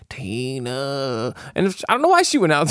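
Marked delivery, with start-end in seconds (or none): 0.1-1.3 soft, creepy calling voice; lightly voiced horror villain calling a name; Freddy voice. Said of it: "That's my best Freddy voice".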